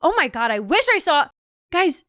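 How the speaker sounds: ordinary talk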